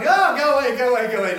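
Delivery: high, loud yelp of fake alarm; hammed-up panicked shouting to go away; mock panic. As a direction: shoo off someone with a cold in jokey fright. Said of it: "I run".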